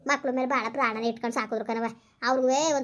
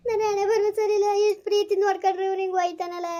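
much higher, louder tearful wail; weeping lament; 6 semitones higher, 3 dB louder than usual. Acted. Mother weeping that her loved son has left. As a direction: cry out against your fate.

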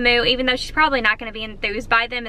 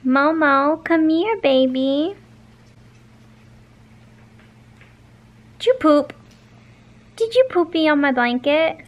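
higher coaxing call; 3 semitones higher than usual. Real, not acted. Owner calling her hamster back onto the blanket, coaxing baby talk. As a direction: coax the hamster back, sing-song.

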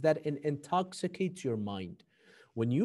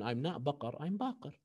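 softly, unprovoked and steady; the Imam calmly turning aside an insult; quiet calm reply; 2 semitones lower, 7 dB quieter than usual. A narrator's reading. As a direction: answer the insult gently, almost under the breath. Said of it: "He told him".

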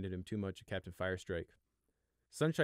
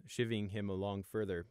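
plain reading voice

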